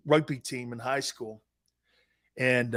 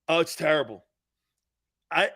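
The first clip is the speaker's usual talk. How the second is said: ordinary talk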